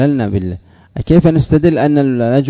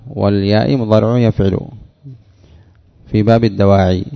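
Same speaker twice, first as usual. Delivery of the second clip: normal talking voice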